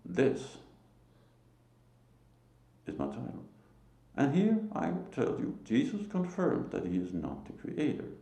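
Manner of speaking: ordinary talk, nothing marked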